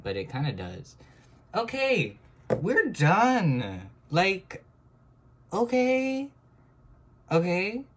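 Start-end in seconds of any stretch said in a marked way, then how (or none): 1.5-7.8 high, loud giddy okays; giddy excitement. Real, not acted. Timer runs out on her drawing, high giddy okays. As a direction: bubble over as time runs out.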